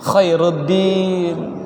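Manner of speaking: very slow "Khairuddin" with a throaty kh; careful Arabic name. Shown as a demonstration. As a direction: draw out each sound. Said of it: "slow name model".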